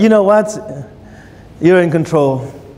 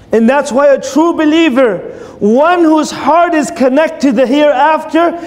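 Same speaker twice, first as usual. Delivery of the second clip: rising preaching; 5 semitones higher than usual. Acted. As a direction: preach it with growing conviction. Builds to a climax.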